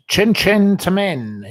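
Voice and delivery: high, loud, slow toast; drawn-out cheers to Min; cheery toast. Real, not acted. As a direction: raise a glass and call it out big.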